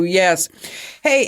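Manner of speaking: usual speaking voice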